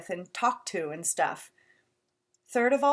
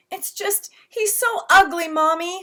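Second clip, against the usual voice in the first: put-on whiny little boy; 9 semitones higher, 7 dB louder than usual. Little boy blurts, high voice.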